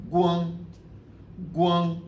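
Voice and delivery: "guang" held level, slightly high; first tone. Shown as a demonstration. Teacher gives another example word, first tone. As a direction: keep "guang" on one even pitch. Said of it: "premier ton".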